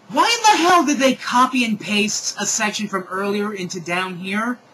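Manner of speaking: high, loud disbelief, easing slightly; loud incredulous complaint; exasperated outburst. Real, not acted. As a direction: burst out baffled at the text. Reader baffled by pasted text.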